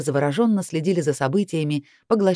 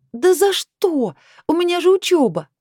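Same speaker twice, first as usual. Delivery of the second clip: whining protest; 12 semitones higher, 5 dB louder than usual. A narrator's reading. Heroine pleading with boss over studies, whining protest. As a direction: wail it out, pitched way up.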